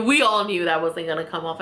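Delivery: usual speaking voice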